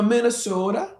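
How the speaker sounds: a hint of a Minnesota accent. Shown as a demonstration. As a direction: lightly demonstrate the Minnesota accent. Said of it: "That's just an accent".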